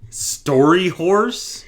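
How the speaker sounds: loud, very drawn-out question; slow, high incredulous echo; exaggerated bafflement. Baffled repeat of odd phrase.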